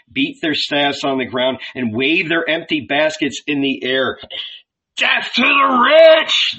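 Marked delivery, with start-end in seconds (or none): 5.0-6.6 high, drawn-out mob cry; shouted. A narrator's reading. Ragamuffins threatening around Jesus, shouted. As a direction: shout it like an angry crowd chanting. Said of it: "they shouted".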